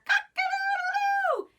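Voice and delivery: very high, fast crowing; rooster crow. Acted.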